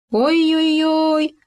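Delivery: long high wail of pain. A narrator's reading. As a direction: howl it out slowly. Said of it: "завопил Хома". (протяжный вопль от боли)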